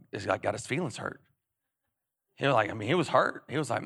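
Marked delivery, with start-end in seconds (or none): none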